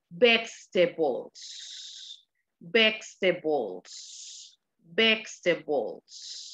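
"vegetables" very slowly as "vech-te-bols"; correct pronunciation. Shown as a demonstration. Teacher modelling a hard word, "vegetables" slow and careful. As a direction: draw out "vegetables" in three slow, careful beats. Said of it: "¿Cuál es la pronunciación correcta?".

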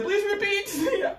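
high, slow Siri voice, laughing; imitating Siri, high and drawn out through laughter; mock Siri voice. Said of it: "Siri said".

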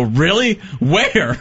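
mock surprise. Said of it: "spit and go".

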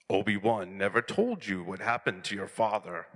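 low imitation of a movie villain; Star Wars villain father. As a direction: rehearse the famous father scene in a deep voice. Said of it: "So I'll be like".

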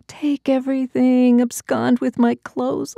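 high, pleading inner cry; silent cry. Acted. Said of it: "I cried silently".

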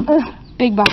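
usual speaking voice

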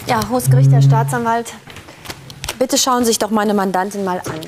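ordinary delivery

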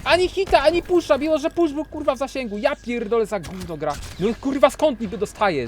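high, loud enraged complaining; high furious rant. Real, not acted. Gamer ranting that his hit and push missed, furious high complaint. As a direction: yell that the game is garbage.

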